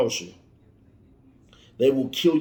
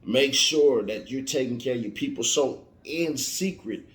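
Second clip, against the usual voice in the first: ordinary delivery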